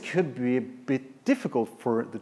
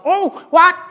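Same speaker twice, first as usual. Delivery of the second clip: squeaky teddy bear voice; 9 dB louder than usual. Acted. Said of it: "See, and also".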